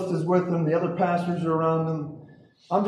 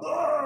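imitated heave, pitched up; retching noise; 8 semitones higher, 3 dB quieter than usual. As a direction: mimic throwing up. Acts out throwing up.

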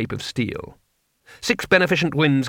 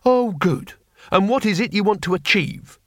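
higher, sarcastic, exasperated interrogation; mildly angry sarcasm, pitched up; steaming anger; 7 semitones higher than usual. A narrator's reading. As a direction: needle her with mock approval. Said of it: "anger was coming off him like steam".

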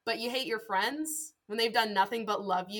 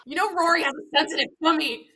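louder and higher, laughing through it; laughing mock plea; 7 semitones higher, 7 dB louder than usual. Mother pleads about Rory's tummy while laughing. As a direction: plead for Rory while cracking up.

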